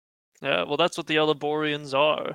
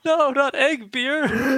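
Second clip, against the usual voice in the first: high, slow wailing cry; mock anguish; 11 semitones higher, 5 dB louder, slower than usual. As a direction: howl in fake horror. Player mock anguish at egg beer.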